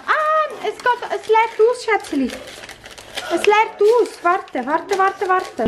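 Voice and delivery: high, urgent pleading; alarmed warning. Real, not acted. Parent to child spilling something, alarmed.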